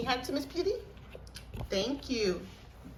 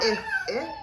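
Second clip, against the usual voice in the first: laughing; 6 dB louder than usual. Real, not acted. Laughing during letter.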